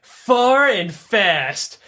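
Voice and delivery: ordinary delivery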